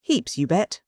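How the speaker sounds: placid American drawl, starting high; unruffled Yankee sea captain accent; placid Yankee skipper. A narrator's reading. Skipper contradicting the professor, placid Yankee accent. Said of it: "remarked the man of the sea placidly".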